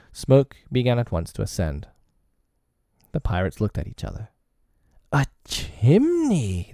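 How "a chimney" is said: high, slow, astonished cry; surprised exclaim. Acted. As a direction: gasp it out in surprise. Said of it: "they both exclaimed".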